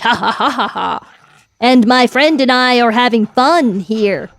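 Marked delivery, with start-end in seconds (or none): 0.0-1.1 evil laugh. Acted. Boss laughs after taunt.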